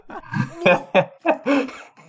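high cackle at his own joke; a player cracking up at his own innuendo; high laugh. Real, not acted.

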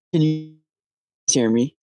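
choppy cut off endings from a bad connection; glitchy, broken-up phone line; robot voice. Real, not acted. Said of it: "You got the robot voice going on".

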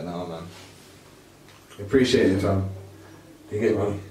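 ordinary talk

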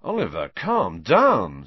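loud, slow attempt to calm; firm, stretched-out soothing; slightly alarmed. A narrator's reading. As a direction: rein someone in with mild alarm. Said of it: "looking slightly alarmed".

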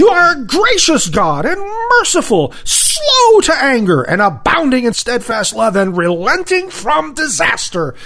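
high angry shout at God with a laugh; shouted in anger. Retold or imitated. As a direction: shout it furiously at heaven. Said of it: "Imagine this being shouted in anger at God".

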